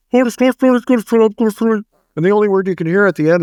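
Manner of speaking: lightly voiced mumbling director; a hint of mush-mouthed talk on the walkie-talkie; mumbling director impression. Mimicking the director on the walkie-talkie, mouthful of mush. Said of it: "and he's going".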